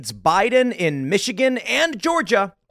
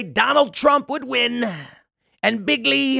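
a light hint of a Trump rally voice, higher; 6 semitones higher, slower than usual. Host briefly channels Trump for viewers, lightly voiced rally boast. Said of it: "the rally voice that he does".

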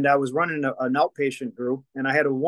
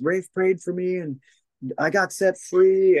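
mock proclaiming shout; 6 semitones higher, slower than usual. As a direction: proclaim it like news from the rooftops. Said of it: "She shouts it from the rooftops".

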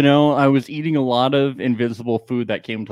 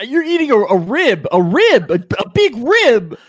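excited guessing; 9 semitones higher, 4 dB louder than usual. Pretend. Guessing the mimed food, hammed.

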